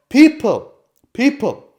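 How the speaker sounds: sudden shouted people; one shouted word mid-rant. Real, not acted. Impassioned rant at official.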